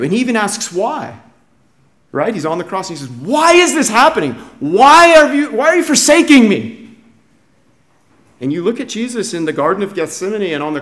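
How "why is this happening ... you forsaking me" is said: loud, high cry of protest; acted anguished pleading, raised and forceful. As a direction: cry out to a father who abandoned you. Acting Jesus crying out on cross.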